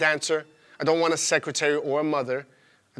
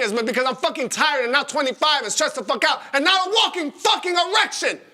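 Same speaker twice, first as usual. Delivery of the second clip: higher, louder rising outburst; angry rant; 9 semitones higher, 5 dB louder than usual. Retold or imitated. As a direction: build the rant to a peak. Ranting back at his ex.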